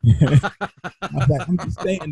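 talking through real laughter; laughing. Real, not acted. Storyteller amused by his friend's joke, laughing as he talks. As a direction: let the laugh break up the words.

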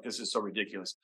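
very soft, low mutter; imitated kid's hushed complaint; under the breath. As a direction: grumble it privately, barely audible. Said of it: "like, under your breath".

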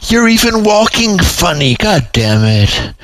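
high-pitched, fed-up reproach; exasperated scolding. A narrator's reading. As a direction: scold him, pitch shooting way up. She scolds her client in exasperation.

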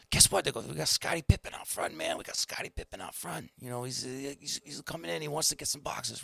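high, hushed, conspiratorial tease; excited stage-quiet heads-up; mock excited tip-off. Staff fake a star sighting.

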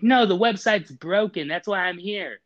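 loud, high frustrated outburst; exasperated. Retold or imitated. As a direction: vent at the robot, fed up. Angry retort to robot.

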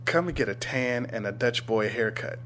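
usual speaking voice

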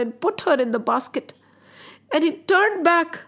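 usual speaking voice

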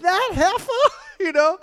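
laughing. Real, not acted. Cracking up over the verse.

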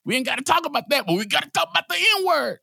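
high-pitched mock outrage; exasperated rant climbing to a high peak; mock indignant rant. Mock tirade about misplaced priorities.

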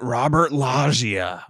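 drawn-out name drop. Pretend. To his cohost, slowly savoring the actor's name. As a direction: stretch the name out like a big reveal. Naming another typecast actor.

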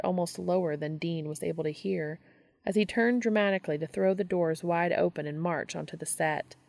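plain reading voice

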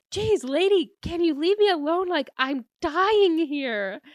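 high, loud pleading; acted high-pitched, fed-up outburst; mock desperate exasperation. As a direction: wail it like someone desperate for the bathroom. Retold desperate plea while being followed.

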